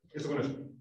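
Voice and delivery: ordinary talk, nothing marked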